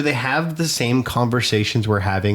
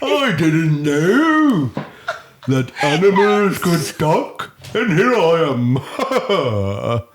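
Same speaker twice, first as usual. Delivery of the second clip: slow, higher and louder hippo drawl; 7 semitones higher, 3 dB louder, much slower than usual. Acted. Still playing the hippo in the animal game, slow and loud. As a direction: stretch every word like a big dopey hippo. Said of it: "That's a hippo".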